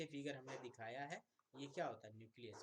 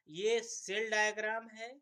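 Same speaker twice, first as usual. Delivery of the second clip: much higher and louder, slow; emphatic loud pointing; 8 semitones higher, 12 dB louder than usual. Real, not acted. Teacher to students, loudly and slowly pointing at the diagram. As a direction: hammer home the diagram name.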